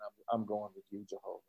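very soft, low murmur; acted quiet pledge from a listener; awed onlooker. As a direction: say it hushed, as if to yourself in the crowd.

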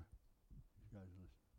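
these guys are listening breathed in a hush; whisper. Real, not acted. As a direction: drop to a secret whisper. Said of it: "repeats it in a whisper".

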